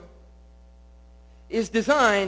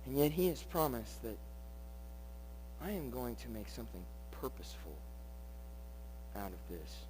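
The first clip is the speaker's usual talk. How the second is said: hushed promise; 9 semitones lower, 20 dB quieter than usual. Acted. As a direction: drop to a much softer, lower voice. Softly delivering God's promise.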